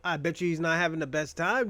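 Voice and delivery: usual speaking voice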